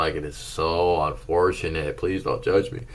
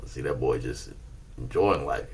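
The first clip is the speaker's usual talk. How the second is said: usual speaking voice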